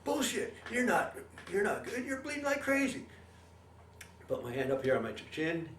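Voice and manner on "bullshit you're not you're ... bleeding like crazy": alarmed buddy. Retold or imitated. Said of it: "and he goes".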